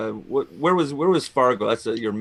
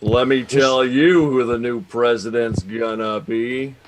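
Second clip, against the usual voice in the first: slow cowboy drawl, a bit louder; John Wayne impression; 5 dB louder, slower than usual. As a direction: lean on it like a movie cowboy.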